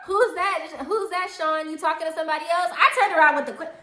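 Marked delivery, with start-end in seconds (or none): 0.1-2.7 high, quick teasing probe; pry playfully in a raised voice; imitated nosy friend, pitched up; teasing nosy question. Acted teasing question from the girl.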